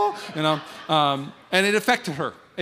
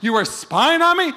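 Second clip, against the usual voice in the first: shocked wife; 12 semitones higher, 8 dB louder, slower than usual. Retold or imitated.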